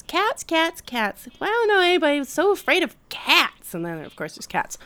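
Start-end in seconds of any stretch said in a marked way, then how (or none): 0.0-3.7 high, squeaky cartoon impression; hammed-up high-pitched imitation; Fievel. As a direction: swoop way up like a little cartoon character. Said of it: "when Fievel just goes".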